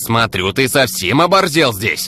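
heated, high-pitched dressing-down; raising his tone. A narrator's reading. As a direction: build from annoyance into loud indignation. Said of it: "повышая тон".

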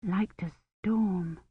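very soft, slow echo; inward thought. A narrator's reading. Kivrin privately mulling over the child's words, hushed thought. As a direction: murmur it slowly to yourself. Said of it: "Kivrin thought".

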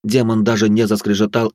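ordinary delivery

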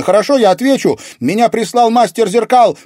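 high, loud, panicked surrender; alarmed girl's cry; frightened confession. A narrator's reading. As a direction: blurt out the answer to save a friend. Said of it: "испугалась Катерина".

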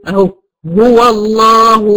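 slow recited هو الله with a full, heavy emphatic lam in الله; correct heavy lam. Shown as a demonstration. Teacher reciting slowly to a student, heavy lam. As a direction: stretch الله with a thick, velarized lam after the open waw. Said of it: "أما أقول".

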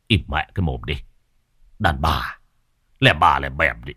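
low, gruff snub; curt retort. A narrator's reading. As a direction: cut the women off in a low voice. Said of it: "Còn ông Nghĩa thì bốp chát".

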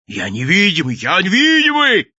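building to a high, loud yell; straining shout. A narrator's reading. Naked blue madman yelling to a laughing crowd, straining shout. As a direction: strain to be heard, climbing sharply. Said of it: "надсаживался человек".

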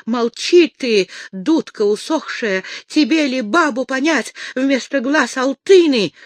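loud, high-pitched scolding; angry rebuke. A narrator's reading. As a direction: shut him up with scorn.

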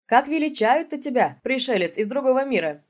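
jolly folksy greeting; hearty. Acted. Friendly loud question.

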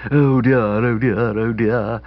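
throaty dowager lamenting; hoarse and throaty. Acted. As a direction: drag out each oh dear in a throaty croak. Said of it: "hoarse, throaty voice".

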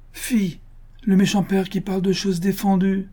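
put-on high-pitched young daughter's voice, lightly reproachful; daughter's mock scolding. A narrator's reading. Daughter chiding her father for forbidden talk, high mock scolding.

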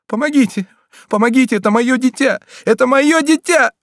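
high, loud desperate cry for help; heart-rending cry. A narrator's reading. Mother calls for help over her injured son, desperate. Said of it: "С этим раздирающим криком".

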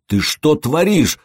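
strangled, slow, rasped question; choking wheeze. Acted. Lad held by the collar, wheezing strangled. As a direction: squeeze it out through a throttled throat. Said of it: "захрипел тот".

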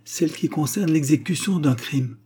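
solemn, high-pitched accusation; weighty formal charge. A narrator's reading. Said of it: "d'un ton solennel".

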